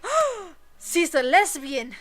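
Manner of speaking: hammed-up astonishment; mock shock. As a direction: blurt it out like a scandalized discovery. Mom's acted shocked realization.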